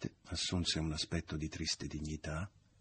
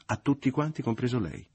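much higher and louder, acid; a jealous woman hurling scorn at her lover; bitter, sarcastic outburst; 8 semitones higher, 8 dB louder than usual. A narrator's reading. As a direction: fling it at him, stinging. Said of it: "ella proruppe amara e sarcastica".

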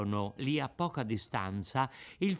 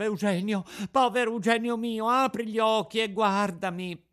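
higher, louder pleading wail, near tears; sobbing desperately; 7 semitones higher, 4 dB louder than usual. A narrator's reading. Pinocchio pleads with fallen schoolmate, desperate tearful wail. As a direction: beg him to wake up, desperate. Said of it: "piangendo dirottamente, disperandosi".

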